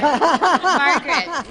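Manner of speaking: loud, slow call across the room. Real, not acted. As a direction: call her name out to the room. Calling on audience member.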